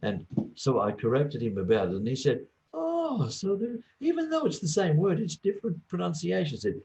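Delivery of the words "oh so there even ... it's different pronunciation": high-pitched voice of dawning realisation; high, wondering "oh, I see" voice; amazed student. Retold or imitated. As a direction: imitate a student suddenly getting it, pitched way up. Acting out student's sudden realisation.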